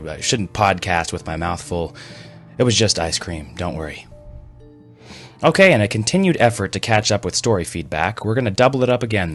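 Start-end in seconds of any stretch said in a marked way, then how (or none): none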